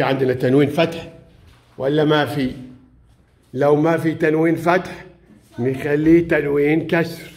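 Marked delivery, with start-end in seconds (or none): none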